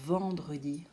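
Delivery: "Vendredi" with a long buzzing "Vvv" at the start; exaggerated first sound. Shown as a demonstration. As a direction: let the V tickle the lips in "Vendredi". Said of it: "d'exagérer le début".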